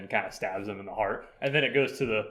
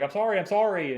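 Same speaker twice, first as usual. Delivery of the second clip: high, taunting repeated sorry; mock apology; 8 semitones higher, 3 dB louder than usual. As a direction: taunt her with a fake apology. Killer mocks her with apologies.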